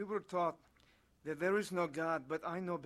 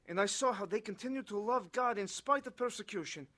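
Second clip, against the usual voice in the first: ordinary delivery, nothing marked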